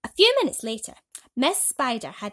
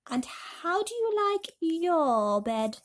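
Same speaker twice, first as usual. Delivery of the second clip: slow, higher caring spider voice; Miss Spider; 8 semitones higher, 3 dB louder, much slower than usual. A narrator's reading. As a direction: ask it like a kind hostess, slowly. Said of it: "she said to James".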